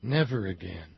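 soft, falling away to near silence; hushed solemn refrain. Acted. Voicing the blood of the war dead, hushed and solemn.

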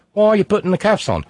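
high-pitched, indignant Aussie protest; hammed-up Australian outrage; mock Australian arrestee. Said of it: "a woman in Oz being arrested".

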